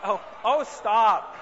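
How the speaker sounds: sudden loud playful "stop"; mock stop. Real, not acted. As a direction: cut the heckler off, half joking. Jokingly stops an audience heckle.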